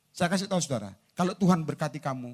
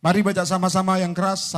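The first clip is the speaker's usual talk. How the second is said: loud, projected invitation; rallying call; 2 semitones higher, 6 dB louder than usual. Real, not acted. Preacher calling congregation to read together, loud rallying call. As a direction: lead everyone in with a strong voice.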